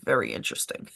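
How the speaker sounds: a hint of conspiracy; knowing. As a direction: drop the voice, deadpan suspicious. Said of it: "I'm like".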